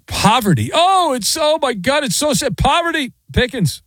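high, hammed-up mock sorrow for Pickens; mock crying. Mocking fans who cry poverty over Pickens, in a high fake wail. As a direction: whine it like a fake mourner. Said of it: "many people crying".

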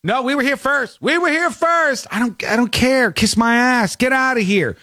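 high mock snarl of a seat hogger; bratty seat hogger. A seat hogger telling others to leave, high bratty mock. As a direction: whine it like an entitled rule-breaker. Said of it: "somebody can't go like".

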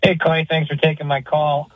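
usual speaking voice

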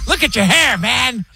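high mocking dad voice; father teasing his son's band-era hair, high and mocking. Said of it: "dad mocking his hair".

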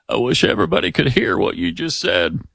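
mock ex-president, voice partly breaking up; hammed-up impression of an impressed politician; Bill Clinton. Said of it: "Clinton was so impressed with him".

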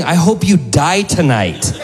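high, slow, deliberate curse; imitated woman doubling down; homeless woman cursing. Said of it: "She goes".

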